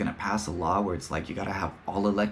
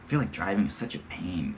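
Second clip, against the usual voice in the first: ordinary talk, nothing marked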